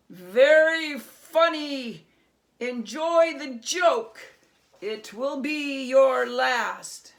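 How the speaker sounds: very loud, slow threat; sneering goblin king. Acted. Goblin king sneering a deadly threat at Herschel. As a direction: hiss out the threat.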